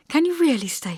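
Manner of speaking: put-on high, hopeful young woman; hardly daring to believe it; eager Hester. A narrator's reading.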